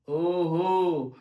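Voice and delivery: long, slow drawn-out "oho"; imitating a girlfriend's knowing reproach; mock accusing. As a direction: stretch it out like a suspicious girlfriend. Girlfriend's sarcastic reproach, acted.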